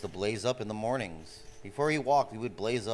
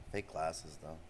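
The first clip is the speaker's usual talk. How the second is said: softer repeat of "Fake glasses"; quiet aside; 6 semitones lower, 8 dB quieter than usual. Real, not acted. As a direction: mutter "Fake glasses" under your breath.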